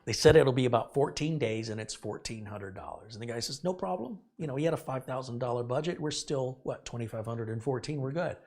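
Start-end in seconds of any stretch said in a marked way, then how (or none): 3.6-4.2 easygoing guy. Retold or imitated. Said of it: "Like, super easygoing guy".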